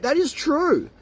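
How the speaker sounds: high, mocking "That is true"; sarcastic agreement. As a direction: agree with a sneer.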